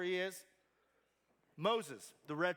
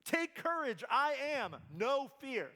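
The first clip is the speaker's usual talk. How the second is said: emphatic yell; 7 semitones higher than usual. Retold or imitated. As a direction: shout it so they hear through the wind. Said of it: "That's what Jesus does here".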